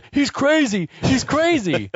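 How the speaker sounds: high, frantic yell; panicked shout. Retold or imitated. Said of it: "we hear the driver yell".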